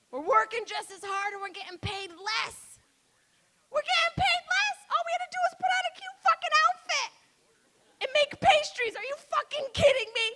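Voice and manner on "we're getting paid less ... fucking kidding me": high, fast, indignant squeal; outraged. Real, not acted. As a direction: rant higher and faster in disbelief. Outraged rant on pay.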